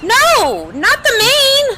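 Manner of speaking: high, loud, drawn-out cry; panicked cry. Acted. Seagulls pulling at her mane, a high panicked wail. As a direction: wail it slow and high in alarm. Pony struggles against seagulls.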